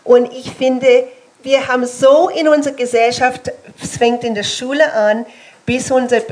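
ordinary talk, nothing marked

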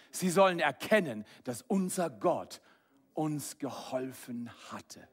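ordinary talk, nothing marked

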